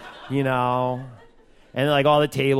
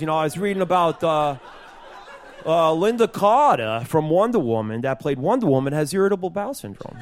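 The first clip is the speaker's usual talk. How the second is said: cousin Joey; 4 semitones higher than usual. Acted. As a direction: do your cousin as an eager amateur comic. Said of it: "this would be my cousin Joey doing stand-up comedy".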